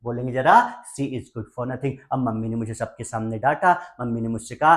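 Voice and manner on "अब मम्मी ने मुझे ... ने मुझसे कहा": ordinary talk, nothing marked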